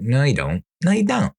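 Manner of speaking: mock scoffing, pitch leaps up high on the second no you don't; a mild sarcastic comeback, rising high; scoffing retort. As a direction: throw it back at them, disbelieving. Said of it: "It's like".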